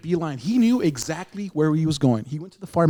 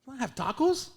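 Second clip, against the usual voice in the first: surprised; 9 semitones higher, 6 dB quieter, faster than usual. Retold or imitated. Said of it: "I was like".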